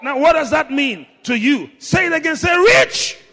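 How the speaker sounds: high, loud, stretched-out proclamation; full-force preaching. Real, not acted. Preacher making the congregation say rich, full-force preaching. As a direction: rouse the crowd to repeat it.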